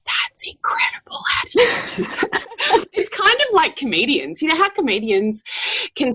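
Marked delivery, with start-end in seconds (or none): 0.0-2.5 whispered, half-laughing amazement; awed whisper. Retold or imitated. Mother marvelling at her toddler, whispering in awe. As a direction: breathe it out in stunned admiration.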